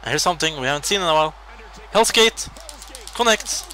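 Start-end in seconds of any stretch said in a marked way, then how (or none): 1.9-3.6 high, loud hype call; thrilled play-by-play yell; excited call. Real, not acted. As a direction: shout the move like a commentator. Calling a big move.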